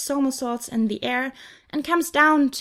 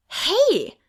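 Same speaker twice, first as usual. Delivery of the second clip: higher, louder offended protest; indignant cry; 8 semitones higher, 5 dB louder than usual. A narrator's reading. Angel protesting Peter's jab at girls, indignant. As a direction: bristle at the insult.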